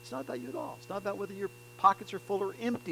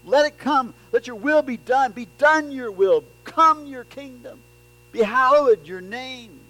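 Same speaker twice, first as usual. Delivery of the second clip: loud, higher, urgent declarations; forceful declarations; 9 semitones higher, 14 dB louder than usual. Shown as a demonstration. Preacher voicing the prayer to God as a loud urgent declaration. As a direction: proclaim each line like a command to heaven. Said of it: "Note the forcefulness of this".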